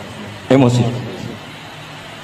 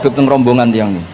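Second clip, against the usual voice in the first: normal talking voice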